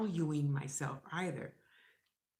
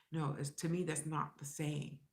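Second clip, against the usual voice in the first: usual speaking voice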